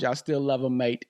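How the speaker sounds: usual speaking voice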